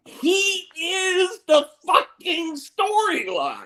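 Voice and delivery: high, slow, drawn-out insistence; slow emphatic declaration. Real, not acted. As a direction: lean hard on every word. Emphatic agreement.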